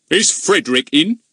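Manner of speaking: high, loud pompous policeman. Acted. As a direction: puff yourself up and ask grandly. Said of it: "in his most pompous voice".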